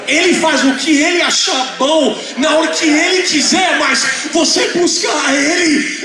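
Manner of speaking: high, insistent pulpit delivery; impassioned preaching. Real, not acted. Preaches at full force.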